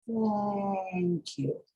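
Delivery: "Thank" with the th held long, tongue out, then gliding into "ank"; "Thank" slow, the th stretched soft into the vowel; exaggerated th practice. Shown as a demonstration. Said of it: "like this".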